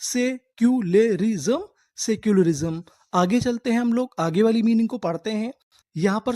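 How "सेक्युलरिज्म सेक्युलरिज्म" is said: slow, rising; slow teacher model of the word; slow drawn-out reading. Shown as a demonstration. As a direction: stretch secularism for the class. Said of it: "क्या बोलते हैं?".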